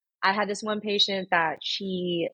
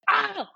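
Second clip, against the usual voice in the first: high, sudden "ah" of alarm; imitated startled yelp; 14 semitones higher than usual. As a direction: mimic a patient's startled cry. Imitates patient mid-enema.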